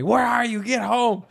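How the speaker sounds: high, loud mock-mother scolding; exaggerated angry mom shout; mom yelling. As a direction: holler it like a mom ordering a teen back. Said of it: "she's yelling at me".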